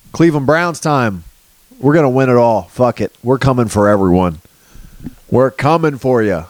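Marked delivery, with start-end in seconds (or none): none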